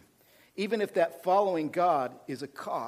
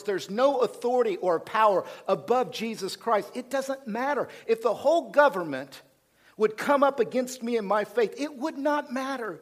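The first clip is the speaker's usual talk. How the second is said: rising preaching; 6 semitones higher, 3 dB louder than usual. Acted. Preaching climax.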